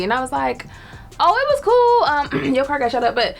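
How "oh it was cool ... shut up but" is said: fake casual. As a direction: play it down to your mom. Telling mom the car got shot.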